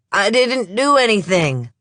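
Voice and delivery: put-on high, loud denial in a slight Southern accent; slight Southern accent, insisting again; repeated denial. Suspect repeating to the police, slight Southern accent. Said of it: "he repeats".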